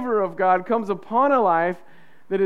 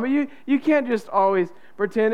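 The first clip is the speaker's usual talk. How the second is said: ordinary talk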